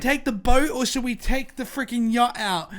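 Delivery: hammed-up wealthy Hillarys resident; posh rich local. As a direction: idly choose between boat and yacht. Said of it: "Or like".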